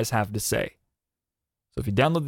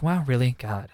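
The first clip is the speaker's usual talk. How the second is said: quiet disappointed sighing. Pretend. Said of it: "I sound like a disappointed dad right now".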